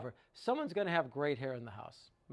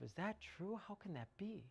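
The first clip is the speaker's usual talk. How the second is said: soft, higher murmur of disbelief; musing to yourself; 4 semitones higher, 13 dB quieter than usual. Acted. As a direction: wonder it quietly, as if privately doubting. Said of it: "You're saying to yourself".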